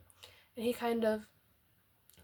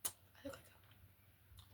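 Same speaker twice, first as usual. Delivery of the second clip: whispered aside; 26 dB quieter, much slower than usual. Real, not acted. Unvoiced aside.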